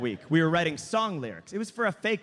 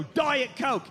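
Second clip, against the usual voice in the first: curt command; 6 semitones higher than usual. Retold or imitated. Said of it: "if all of us could go".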